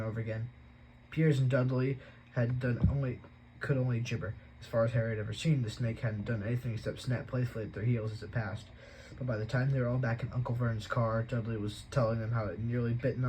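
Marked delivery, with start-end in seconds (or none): none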